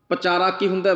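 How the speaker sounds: usual speaking voice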